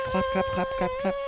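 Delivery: very high, soft squeaked claps; squeaky mock applause. Boyfriend squeaking claps for the host, very high and soft. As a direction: squeak the claps like a tiny cheer. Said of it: "Insert applause".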